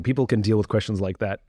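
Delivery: ordinary talk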